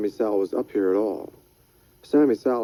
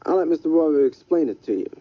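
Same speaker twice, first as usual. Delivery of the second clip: hurried, higher hustler voice; Freddy, quick and higher; 7 semitones higher, faster than usual. Acted. Freddy hands Rydell off to War Baby, quick and higher. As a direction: pass the buck fast.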